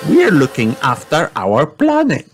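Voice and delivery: put-on high, bright little girl pig; Peppa voice. A narrator's reading. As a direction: play young Peppa, eager. Peppa speaks.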